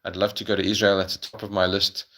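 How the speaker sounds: normal talking voice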